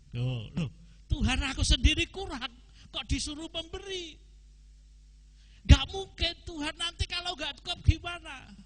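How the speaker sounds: high, fast, indignant protest to God; protesting complaint. Pretend. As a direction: whine it like a doubter who can't believe the order. Jemaat mengeluh kepada Tuhan. (protes tinggi dan cepat kepada Tuhan)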